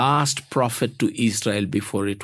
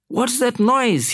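startled boss; 12 semitones higher than usual. A narrator's reading. Acted worried boss hears noise.

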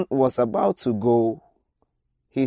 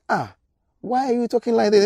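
high and fast, a startled "Ah, why"; shocked protest; 10 semitones higher, faster than usual. Acted. Hazael cries out high and fast to the prophet, shocked. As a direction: protest as if stung by the words.

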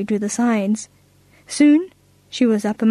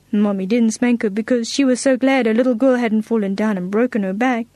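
usual speaking voice

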